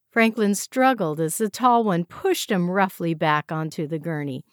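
ordinary delivery

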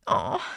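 a real choked sob breaking through; crying sob. Real, not acted. Telling her YouTube story, she breaks into a real sob. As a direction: let the emotion catch in a low sob. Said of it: "I'm gonna get emotional".

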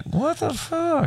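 high, slow, stunned disbelief; baffled what the fuck. Retold or imitated. Retelling the rude customer, stunned and slow. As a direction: let it drop out in slow disbelief. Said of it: "and I'm kinda like".

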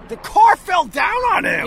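high shocked exclamation. Real, not acted. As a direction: gasp it in disbelief. Shocked at story.

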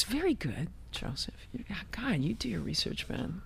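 half-whispered, impressed praise; impressed hush. Real, not acted. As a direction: drop to a near whisper in admiration. Impressed by interviewer's research, mock-confiding.

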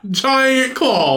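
high, disbelieving repeat; teasing a friend about koala claws; mocking echo. Real, not acted. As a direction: sneer the words back at him.

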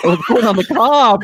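giggling as it clicks; laughing. Pretend. Repeats guess laughing.

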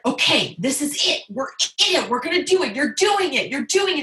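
high, punchy coaching; eager, rhythmic encouragement; excited doctor. Retold or imitated. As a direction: imitate a doctor cheering a patient on. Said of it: "he was so excited".

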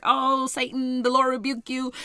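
hammed-up frustrated prayer; frustrated rebuke. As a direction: act out exasperatedly rebuking the devil. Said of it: "I'm getting frustrated".

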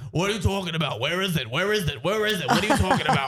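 lightly voiced, exasperated cartoon husband; Elton. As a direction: rattle off mock-baffled questions. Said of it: "why Natalie and Elton sound like that".

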